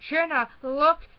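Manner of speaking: high, loud, slow alarmed call; startled cry. Acted. Brother alarmed, calling his sister to look. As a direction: point and shout in shock. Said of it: "Dan stammered".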